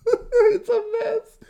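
high, drawn-out words squeezed through laughing; helpless laughter. Real, not acted. Teller summing up the absurd plot, cracking up. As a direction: break up completely while speaking.

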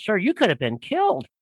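a hint of a wide-eyed lady's concern. Lady in line warns the biker, imitated shock. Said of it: "one of the ladies goes".

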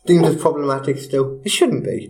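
normal talking voice

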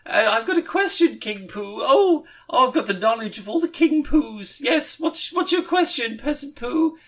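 very high squeaky little-peasant whine; silly peasant voice. Retold or imitated. Peasant poo questioning his king, very high and squeaky. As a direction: pipe up like a tiny grovelling peasant. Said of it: "Zach was like".

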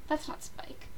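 breathy whisper to yourself; whispered aside. Retold or imitated. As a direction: hush it like a secret. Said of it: "you're like".

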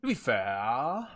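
slow, drawn-out catchphrase; Letterkenny catchphrase. Retold or imitated. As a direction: stretch it out like the show quote. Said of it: "Is that from Family Guy?".

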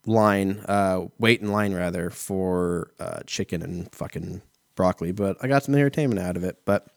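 ordinary talk, nothing marked

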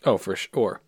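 ordinary talk

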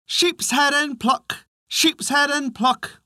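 high, slow chanted refrain; drawn-out singsong on a high pitch; repetitive singsong cry. A narrator's reading. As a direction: chant it over and over so as to remember. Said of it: "kept saying all the way".

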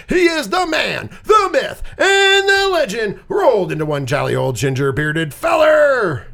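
rising ring announcer hype; hype intro. Acted. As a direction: pump up the crowd.

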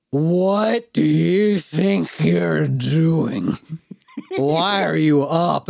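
put-on slow, dragged drunken rebuke; slurred scolding. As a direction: drag it out like a wasted friend.

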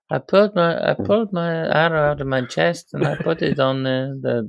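high put-on Spanish accent; a lightly put-on Spanish accent as Pedro. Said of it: "We were gonna do the Pedro going".

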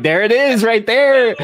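high, loud triumphant call-out; excited. Retold or imitated. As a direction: pounce on it like catching him out. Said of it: "I'm like".

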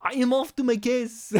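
put-on French accent. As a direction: do a comic French villain. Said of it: "I'm not doing the French accent. I should do it".

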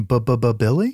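mock stammer on the B of "Billy"; imitated stutter. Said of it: "who stutters really bad".

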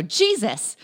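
startled gasp of Jesus; startled yelp. Retold or imitated. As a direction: jump out of your skin. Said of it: "I clench my chest, and I go".

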